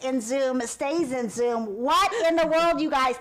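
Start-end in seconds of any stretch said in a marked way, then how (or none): 1.8-3.2 what in the world, pitched up and loud; loud startled cry; surprised exclamation. Real, not acted. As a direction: burst out in surprise at the chat. Host surprised by the chat.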